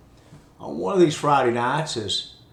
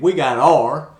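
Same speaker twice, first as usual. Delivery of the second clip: louder Appalachian repeat; Appalachian accent; 9 dB louder than usual. Retold or imitated. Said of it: "He repeated".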